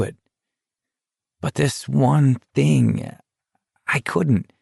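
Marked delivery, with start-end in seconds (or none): 1.4-4.5 slow, pained and higher; forcing out the words; choked up. A narrator's reading. Choked confession about Jan.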